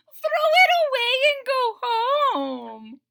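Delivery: high, slow, loud sneer; mock it like a smug little bird; robins' voice. Robins mocking Toad's crashed kite, high slow sneer. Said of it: "said the robins".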